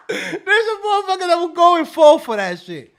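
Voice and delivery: high laughing voice. Real, not acted. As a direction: say it while cracking up. Laughing while speaking.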